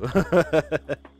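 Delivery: loud, high run of laughter; amused laugh. Real, not acted. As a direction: burst out laughing at the mix-up. Laughing at the screen mix-up.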